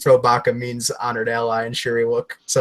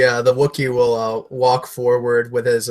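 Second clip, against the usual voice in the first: ordinary delivery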